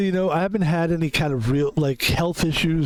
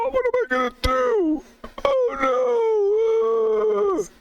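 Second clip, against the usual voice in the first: high voice climbing into a squeaky falsetto; voice cracking ever higher; 14 semitones higher than usual. Pretend. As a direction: pretend your voice is giving out, squeaking higher and higher. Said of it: "if they talk like that".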